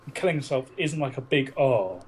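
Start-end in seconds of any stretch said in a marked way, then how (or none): none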